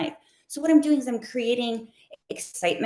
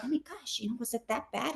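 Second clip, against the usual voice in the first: hushed mock dismay; 3 semitones higher, 11 dB quieter than usual. Host replaying her worry to her sister, in hushed mock dismay. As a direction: act out a worried, hushed reaction.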